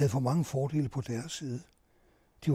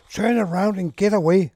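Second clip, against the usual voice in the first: loud, higher-pitched narrated shout; agitated shout; 4 semitones higher, 9 dB louder than usual. A narrator's reading. Soldiers ordering reporters to turn back, agitated shout. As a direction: call it out sharply as an agitated order. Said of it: "skreg de ophidset".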